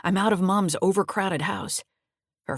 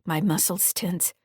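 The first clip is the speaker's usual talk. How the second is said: usual speaking voice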